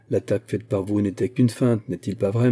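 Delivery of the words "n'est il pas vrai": plain reading voice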